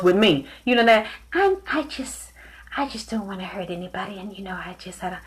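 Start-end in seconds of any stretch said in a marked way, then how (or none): 1.3-5.2 very soft, hesitant impression; Michael. Imitating Michael not wanting to hurt anybody, very softly. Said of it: "Michael don't talk like that".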